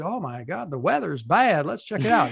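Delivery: hammy Texas twang; Texas accent. Acted. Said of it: "That's the best Texas accent I can muster there".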